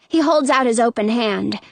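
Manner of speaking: usual speaking voice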